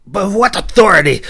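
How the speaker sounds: high, loud disbelieving challenge; stammering denial. Acted. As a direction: deny it in shock. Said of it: "Nathia stammered in denial".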